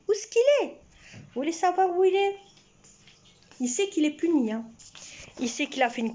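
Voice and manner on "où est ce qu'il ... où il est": higher, quick sing-song teasing; playful teasing call. Acted. Woman teasing her punished cat in front of viewers, sing-song. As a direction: tease the sulking cat.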